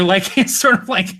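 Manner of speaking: normal talking voice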